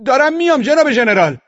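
very high, full-voiced yell; shouted reply. A narrator's reading. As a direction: yell back to the general across camp. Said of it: "من هم داد زدم". (داد زدن در جواب)

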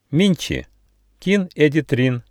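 mildly loud call to strangers; loud announcement. A narrator's reading. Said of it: "громко сказал Нюфа".

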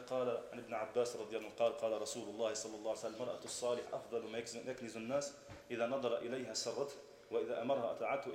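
rapid, low Arabic recitation; hadith recitation. Retold or imitated. Husband citing his proof to his wife, rapid recitation. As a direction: recite it fast and low from memory.

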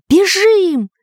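high, loud cry of alarm; frightened high yelp; panicked wail. A narrator's reading. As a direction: wail it to get everyone fleeing. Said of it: "простонал Дырка".

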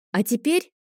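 put-on a small boy who can't wait any longer; demanding child bursting out; impatient outburst. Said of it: "первым взорвался нетерпеливый Леонард".

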